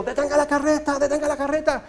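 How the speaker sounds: high, panicked shout to stop the coach; frantic maiden's cry. Retold or imitated. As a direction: beg the driver to stop, high and urgent. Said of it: "así que le grita al cochero". (la doncella le grita al cochero, aguda y desesperada)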